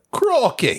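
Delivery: Australian crikey. Shown as a demonstration. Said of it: "The way they say it".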